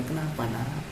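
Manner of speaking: put-on soft, tender voice to a crying child; gentle comforting. Teacher gently comforting a sad pupil who arrives crying, soft and tender. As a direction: softly console the sad pupil. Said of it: "Simpati dengan dia".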